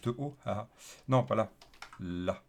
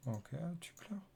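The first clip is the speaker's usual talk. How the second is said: coaxing whisper; 3 semitones higher, 13 dB quieter than usual. Acted. Coaxing the crying app.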